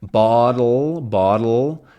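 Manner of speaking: "Bottle" with no vowel, tongue held from d to l; syllabic l. Shown as a demonstration. Teacher modelling a syllabic l, no vowel sound. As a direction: let the d slide straight into l. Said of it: "my tongue stays from the d to the ul".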